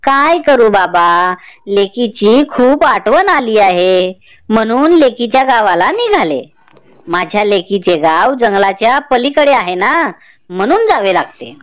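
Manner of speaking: grandmother telling her neighbour she is off to visit her daughter; old grandmother. Acted. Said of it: "म्हातारी म्हणाली".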